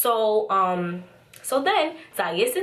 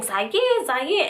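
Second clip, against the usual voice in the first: higher-pitched mild mock yell; imitated yelling; 7 semitones higher than usual. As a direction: lightly imitate her repeated calling. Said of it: "She keeps yelling".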